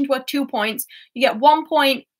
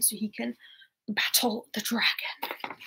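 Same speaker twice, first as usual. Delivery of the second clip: whispered; 3 semitones lower, 9 dB quieter than usual. Real, not acted. Quizmaster trailing off.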